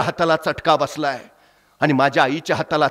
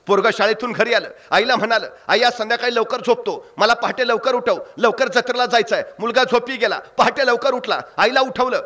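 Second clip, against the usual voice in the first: emotive Marathi storytelling, rising with the drama; story narration; 8 semitones higher than usual. A narrator's reading. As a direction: tell it like a village storyteller moving the crowd. Storyteller narrating village tale.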